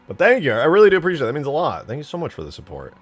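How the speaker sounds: loud, high-pitched burst of gratitude; effusive thanks. Real, not acted. Thanking a donor for support.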